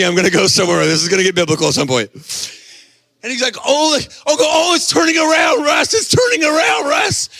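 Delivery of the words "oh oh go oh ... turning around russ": high-pitched excited cries; thrilled fan. Retold or imitated. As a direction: call out to your brother in mock amazement. Acts fan calling brother at comeback.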